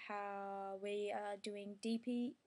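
ordinary talk

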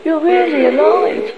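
high-pitched exclamation of relief; joyful cry. A narrator's reading. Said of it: "she cried".

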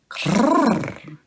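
high rolling cat trill; a high purring crrr; cat cry. A narrator's reading. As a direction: trill eagerly like a cat running to milk. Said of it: "she cried".

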